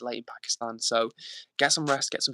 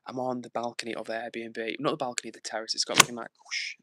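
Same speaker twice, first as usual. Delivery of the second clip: normal talking voice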